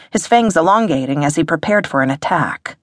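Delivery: usual speaking voice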